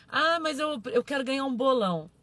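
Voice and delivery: loud, quick mock objection; hammed-up eager complaint; mock impatient follower. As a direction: imitate someone protesting impatiently.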